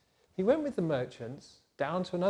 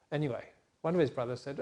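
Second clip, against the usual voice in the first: usual speaking voice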